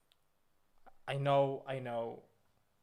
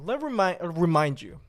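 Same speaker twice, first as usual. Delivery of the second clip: loud; 8 dB louder than usual. Acted. Loud restart after stumble.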